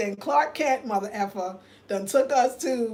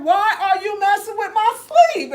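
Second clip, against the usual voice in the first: higher and louder, half-laughing complaint; annoyed; 9 semitones higher, 7 dB louder than usual. Retold or imitated. Retelling complaining to Kwame about lost sleep. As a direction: whine it up high through a laugh. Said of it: "I'm like".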